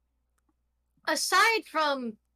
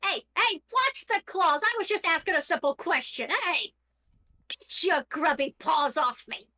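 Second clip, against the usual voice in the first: higher indignant ghost yelling; complaining voice; 4 semitones higher than usual. Acted. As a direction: protest from far away. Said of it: "a distant voice complaining".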